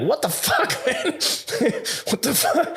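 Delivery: high, laughing what-the-hell; giggling incredulous retelling; laughing disbelief. Retold or imitated. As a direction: laugh through the shock. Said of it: "And I'm like".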